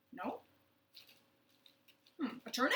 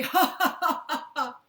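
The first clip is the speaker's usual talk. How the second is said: big loud laughing ha ha; 6 semitones higher, 12 dB louder than usual. Shown as a demonstration. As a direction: belt out a hearty laugh. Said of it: "Or sometimes we say".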